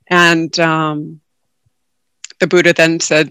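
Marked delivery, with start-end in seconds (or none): none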